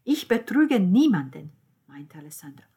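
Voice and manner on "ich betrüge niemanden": loud, raised protest; emphatic denial. Acted. Alessandro denies cheating before the family, protesting loudly. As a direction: insist on innocence forcefully.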